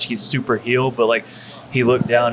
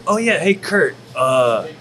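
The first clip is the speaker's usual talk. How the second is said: casual friend's voice; 7 semitones higher, slower than usual. Retold or imitated. Friend Delirious delivers big news.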